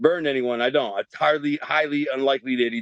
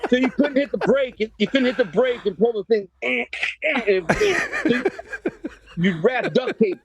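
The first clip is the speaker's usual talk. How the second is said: laughing; 8 semitones higher than usual. Real, not acted. Laughing at his own junk moped.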